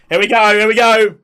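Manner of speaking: high, loud build-up; rally everyone for the big moment; excited call. Real, not acted. Streamer cueing the chorus, excited shout.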